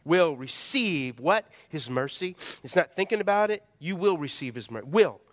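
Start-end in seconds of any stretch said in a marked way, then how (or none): 0.0-1.2 "will" slow and emphatic; stress on will. Shown as a demonstration. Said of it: "underline that word will". 4.9-5.2 "Will" louder, short and falling; stress on will. Shown as a demonstration. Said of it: "That's not a maybe".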